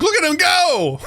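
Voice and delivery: high-pitched retold cheer of amazement; impressed cheer. Recalling amazement at a friend's accent on stage, impressed cheer. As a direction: cheer him on in a high, excited voice. Retelling his awe at friend's performance.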